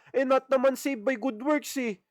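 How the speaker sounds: preacher mimicking a smug believer, mocking and high; mocking boastful believer. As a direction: sneer it out like a know-it-all believer. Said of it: "At magyayabang pa".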